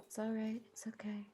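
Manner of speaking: hushed, near-whispered comfort; very soothing. Acted. Drover massages the steer's ear, very soothing. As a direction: softly soothe a spooked animal. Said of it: "just very soothing, like".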